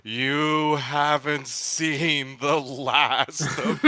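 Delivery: very slow, dragged-out, laughing; hammed-up slow drawl; slow-talking vendor. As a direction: stretch every word painfully slow. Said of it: "incredibly slow-talking hot dog vendor".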